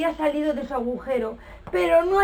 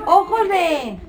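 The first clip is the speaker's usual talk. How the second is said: loud call; 4 semitones higher, 5 dB louder, slower than usual. Acted. Teacher starting a rhyme.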